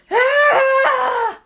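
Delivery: high, loud cry of agony; scream of pain. Acted. Quirrell screaming as Harry grabs his face, high and loud. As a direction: howl as your face burns.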